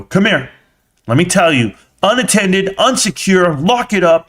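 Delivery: high, emphatic telling-off; mock scolding lecture. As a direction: act out an indignant parental lecture. Mimes the lecture he didn't give.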